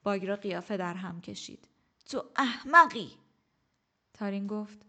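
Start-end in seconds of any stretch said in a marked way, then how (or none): 2.0-3.1 put-on loud, scornful old woman's outburst; angry insult. A narrator's reading. Old teacher flaring at the stubborn general, loudly angry. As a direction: spit the insult at him.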